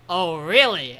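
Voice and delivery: loud, swooping "oh, really"; exaggerated reaction, punched up loud; mock reaction. Podcaster voicing Eiji's reply to the sister, loud mock reaction.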